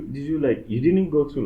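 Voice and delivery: ordinary delivery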